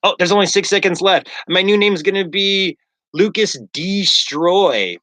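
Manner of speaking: hammed-up villain racing the clock; unibrow guy. Unibrow guy blurting the new name before cutoff, hammy. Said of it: "the guy with the unibrows".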